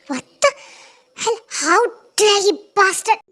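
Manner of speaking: loud outraged yell, slowed; furious outraged shout. Acted. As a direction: hurl it at the bastard. Woman catching the attacker.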